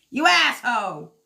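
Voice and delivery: mock yell at a card. Real, not acted. Reader scolding the King card, mock angry. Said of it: "I'm screaming at the King Cup".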